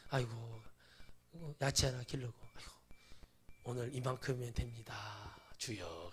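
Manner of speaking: soft, half-whispered murmur to God; hushed pious prayer. Pretend. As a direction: mumble it like a contented hermit praying. Imagined quiet pious life prayer.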